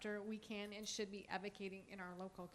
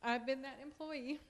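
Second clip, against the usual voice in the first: fighting back tears while speaking; getting emotional; 6 semitones higher than usual. Real, not acted. First-time speaker at board meeting, choking up. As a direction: voice catching, pitched higher with feeling. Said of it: "I don't know why I'm getting emotional".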